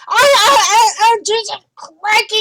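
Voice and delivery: high, loud screech of pain. Retold or imitated. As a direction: screech as if just scalded. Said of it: "like, screeching".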